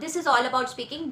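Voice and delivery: ordinary talk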